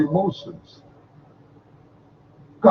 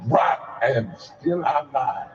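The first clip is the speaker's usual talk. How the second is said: slow poetic refrain; 8 semitones lower, 5 dB quieter than usual. Shown as a demonstration. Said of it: "Say it again".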